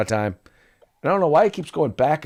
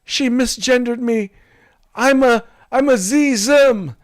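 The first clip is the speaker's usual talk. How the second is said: high, put-on aggrieved voice; high mock offended whine; whiny offended voice; 12 semitones higher, 4 dB louder than usual. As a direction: complain like a wounded snowflake. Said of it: "the person says".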